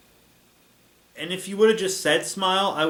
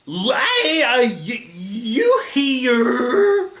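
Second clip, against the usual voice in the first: put-on high, loud, drawn-out Italian accent; over-the-top Italian accent, halting and slow; Ghidorah; 8 semitones higher, 7 dB louder, much slower than usual. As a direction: stretch every word in a hammy Italian accent. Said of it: "doing an Italian accent for Ghidorah".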